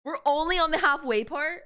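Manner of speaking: can't believe it's only halfway; surprised. Real, not acted. Player shocked at game length.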